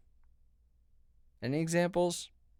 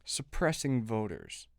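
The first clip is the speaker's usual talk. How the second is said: normal talking voice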